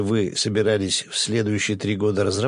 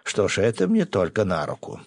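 usual speaking voice

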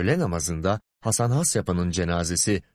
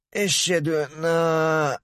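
slow, fading dying whisper of prayer; last breath, prayer cut off; death groan; 7 semitones higher, slower than usual. A narrator's reading. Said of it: "Hasan son nefesini verirken". (son nefeste kesilen şehadet)

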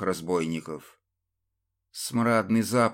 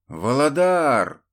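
shouted name, drawn out; calling out; 4 semitones higher, 5 dB louder, slower than usual. A narrator's reading. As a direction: call your brother across the fight. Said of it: "закричал брату".

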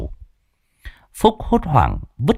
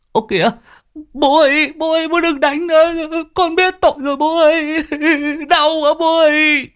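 put-on very high child's voice, crying out in pain; a beaten boy yelping for mercy. Son begs his father to stop the beating, high pleading yelps. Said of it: "kêu lên oai oái".

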